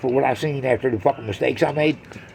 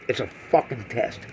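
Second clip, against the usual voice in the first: "It's a fucking test" said again much softer; quiet repeat; 6 semitones lower, 9 dB quieter than usual. Real, not acted. Comic repeats the line to the crowd, much softer. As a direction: drop the repeat right down to a murmur.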